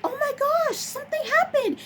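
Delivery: very high, breathless imitation of a frantic mother; frantic mom. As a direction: squeal it like a panicked mom. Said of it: "the mom came in and she was just like".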